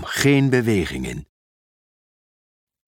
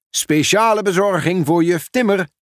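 higher, mildly projected announcement; calling down; 7 semitones higher than usual. A narrator's reading. Pieter Post calls down from the helicopter to the teacher, projecting lightly. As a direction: call a delivery down from above, pitch raised. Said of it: "riep Pieter Post naar beneden".

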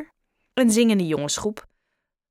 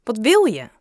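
angry; 3 semitones higher, 6 dB louder than usual. Acted. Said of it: "vroeg hij boos".

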